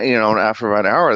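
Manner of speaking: ordinary delivery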